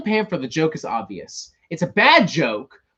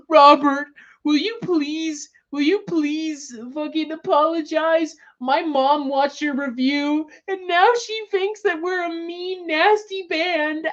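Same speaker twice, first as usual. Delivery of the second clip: high, slow, whiny crying voice, mocking the band; pleading like a hurt child, voice far higher and slow; whiny mock band member; 12 semitones higher, slower than usual. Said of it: "be like".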